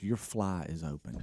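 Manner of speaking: quiet, slow, hushed and giggly; hushed warning. Retold or imitated. Wife tells her husband his fly is open, hushed giggle. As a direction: lean close and warn him softly, barely holding a laugh. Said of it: "She goes".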